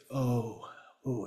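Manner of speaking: "O" softly, as a long oh. Shown as a demonstration. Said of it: "it's a soft O".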